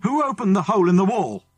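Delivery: loud, high angry shout; a man's furious shout. Acted. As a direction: bellow it down the tunnel. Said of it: "there came a shout".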